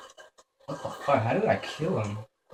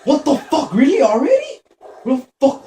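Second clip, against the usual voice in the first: high, loud startled yelling; startled panic; 8 dB louder than usual. Real, not acted. Player startled by the game, shrieking in panic. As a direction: shriek it out in shock. Jump scare during horror game.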